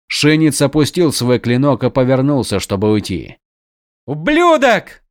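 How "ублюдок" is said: high furious shout. A narrator's reading. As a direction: yell it out after him. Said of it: "заорал Адалин".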